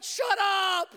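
high, loud snapping yell; annoyed shout. Retold or imitated. Boy in the bathroom yells at his impatient friend. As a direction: yell it back in frustration.

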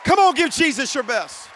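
loud, high rallying cry; preaching at full force. Real, not acted. Pastor rallying the conference to praise Jesus, shouting. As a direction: whip the crowd into praise.

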